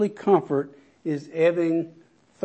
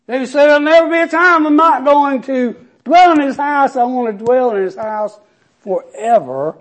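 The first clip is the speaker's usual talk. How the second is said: loud, high, rising preacher's cry; preaching at full force; 7 semitones higher, 6 dB louder than usual. Acted. Pastor rousing the congregation with the promise, preaching loud. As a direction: build the scripture up like a sermon climax.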